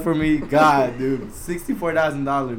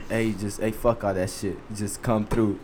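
low, hushed brush-off; soft, confidential phone voice; serious voice; 8 semitones lower, 7 dB quieter than usual. Retold or imitated. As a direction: keep it low and serious, like a quiet favor. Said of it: "I like your serious voice".

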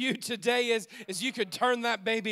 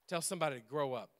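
quick soft aside; 8 semitones lower, 10 dB quieter, faster than usual. Acted.

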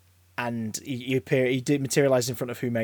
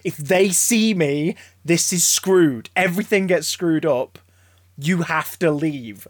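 slow, emphatic, raised-pitch warning; Mickey; 4 semitones higher, 5 dB louder, slower than usual. Retold or imitated. Mickey warning the Doctor to go, acted emphatic insistence. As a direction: insist on it word by word, loudly.

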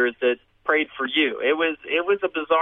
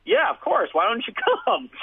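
much higher, put-on agreeable voice; 12 semitones higher, slower than usual. Teller sitting there as friend invites homeless man, put-on agreeable. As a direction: go high as if happy to invite him.

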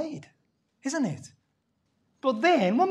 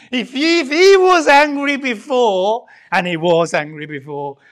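preaching louder about Jonah's anger; slow, heated preaching voice, much louder; mad preaching; 11 dB louder, slower than usual. Acted. Preacher builds up to Jonah's fury, loud and slow. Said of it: "he is mad now".

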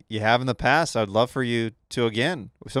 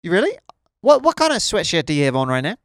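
high, louder Kiwi accent; put-on New Zealand lilt, pitched up; 4 semitones higher, 5 dB louder than usual. Shown as a demonstration. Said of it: "your best New Zealand accent".

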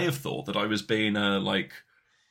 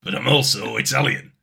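loud, hammed-up cartoon Italian accent; Mario Italian accent; 7 dB louder than usual. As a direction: ham up a cartoon Italian plumber. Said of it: "my name's Mario".

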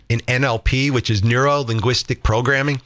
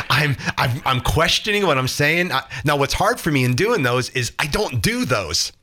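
usual speaking voice

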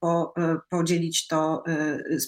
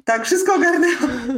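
higher, louder, laughing reply; 6 semitones higher, 7 dB louder than usual. Real, not acted. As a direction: laugh through the words. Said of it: "Wszystko okej?". (ze śmiechem)

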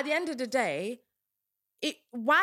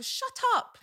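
high, exasperated shut up; 13 semitones higher than usual. Retold or imitated. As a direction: cut them off in disbelief. Ranting about guys' lies.

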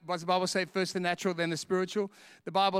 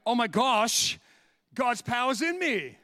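higher, louder, slow amazement; awed realization; 4 semitones higher, 5 dB louder, slower than usual. Retold or imitated. Preacher recalling his amazement at God's power, higher awed exclaim. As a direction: marvel at it.

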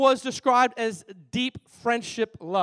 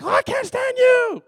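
much higher and louder mock yell; fed-up outburst; 10 dB louder than usual. A spouse fed up with toothpaste mess, mock yelling. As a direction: burst out at a spouse. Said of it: "You're like".

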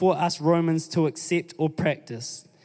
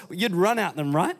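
ordinary talk

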